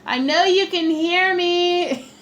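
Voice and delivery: loud, high, slow mock yell; playful loud slow call to husband. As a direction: yell it across the house, hamming it up. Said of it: "I'll yell".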